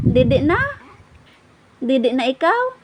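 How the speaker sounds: high, giggly cooing; baby talk. Acted. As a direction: coo brightly at the baby. (mataas at masayang boses sa sanggol)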